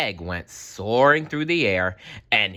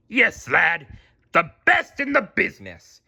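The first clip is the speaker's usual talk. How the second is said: higher, louder proud boast; proud Mister Krabs; 8 semitones higher, 4 dB louder than usual. A narrator's reading. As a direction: puff up about his cook. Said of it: "replied Mister Krabs proudly".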